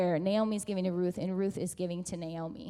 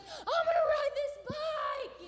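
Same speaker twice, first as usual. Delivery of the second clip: very high, drawn-out strained cry; pumped-up child; 4 dB quieter than usual. Retold or imitated. Daughter vows to ride to her mother, pumped up. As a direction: squeal the vow out slowly. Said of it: "And she's like".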